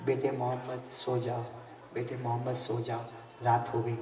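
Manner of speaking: low, soft, tender coaxing; soft lullaby plea. Acted. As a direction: hush the baby gently to sleep. Mother lulling child to sleep. (धीमी, कोमल, लोरी जैसी मनुहार)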